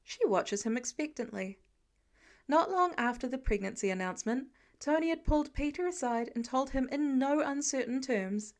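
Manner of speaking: ordinary delivery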